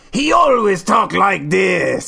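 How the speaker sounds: slow, loud caricature of a gamer; put-on, drawn-out shouty impersonation; Let's Player's shouting voice. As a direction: boom it out in a hammed-up character voice. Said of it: "there was this Let's Player I remember".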